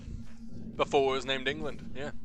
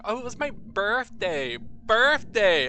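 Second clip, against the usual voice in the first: mock Southland accent, higher, hard R in birthday; 8 semitones higher, 5 dB louder, slower than usual. Streamer mimicking a cousin from Southland, exaggerated Southland accent. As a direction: imitate a Southlander leaning on the R. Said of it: "Southlanders have the, have it the worst".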